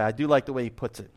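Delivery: usual speaking voice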